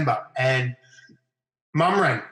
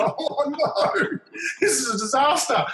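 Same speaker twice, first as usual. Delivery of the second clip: laughing, very high mock panic; a mock disaster cry squeezed out in laughter; laughing dismay; 12 semitones higher, slower than usual. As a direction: caught out, laughing through it. Caught out by the coach.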